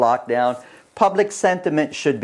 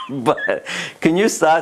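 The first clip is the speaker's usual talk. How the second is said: laughing plea; 3 semitones higher than usual. Real, not acted. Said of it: "the guy behind the camera here is making me laugh".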